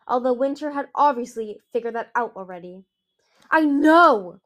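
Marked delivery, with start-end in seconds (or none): 3.5-4.4 very loud, raised angry roar. A narrator's reading. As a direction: roar it in exasperation. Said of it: "Winter roared".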